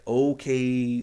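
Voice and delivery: drawn-out loud call to focus; loud, slow "okay" that stops everything. Shown as a demonstration. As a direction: stretch it out and call the room to order. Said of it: "opening with "Okay" is a way to do that".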